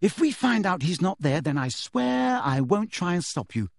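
ordinary delivery, nothing marked

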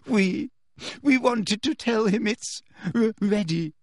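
high, stammering tearful confession; choked. A narrator's reading. Girl feigns reluctant confession to teacher, choked. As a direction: choke on the last word. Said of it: "choked Hermione".

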